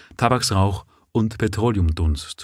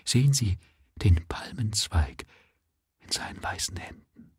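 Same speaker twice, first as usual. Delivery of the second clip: feverish whisper; 2 semitones higher, 6 dB quieter than usual. A narrator's reading. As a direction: whisper in dying rapture. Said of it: "flüsterte geheimnisvoll".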